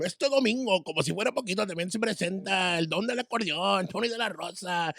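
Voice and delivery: high, hyped Spanish radio announcer; mimicked radio DJ. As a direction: belt out a Tejano concert promo, rolling along. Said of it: "I would start mimicking him".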